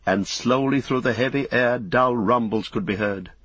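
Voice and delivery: usual speaking voice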